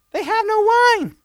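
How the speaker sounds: high mock mother voice. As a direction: play Mary as a fretting mother. Mary voiced high in retelling.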